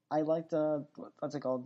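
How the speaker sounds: ordinary delivery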